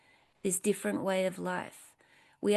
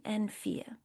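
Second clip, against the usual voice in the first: whispered fear; 6 dB quieter than usual. Shown as a demonstration. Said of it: "fades to a whisper".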